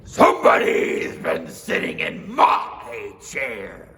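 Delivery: slow, loud, gruff bear; Papa Bear voice. Acted. Papa Bear warning his family, slow and loud. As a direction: growl out the warning slowly.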